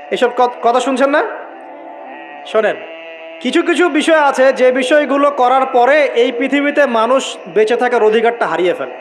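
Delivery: usual speaking voice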